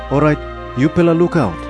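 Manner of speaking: ordinary delivery, nothing marked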